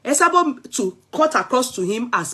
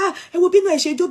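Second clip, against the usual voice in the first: higher, fast gossiping mimicry; mocking gossip voice; 9 semitones higher, much faster than usual. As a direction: imitate a gossiping crowd, quick and high. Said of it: "everybody say".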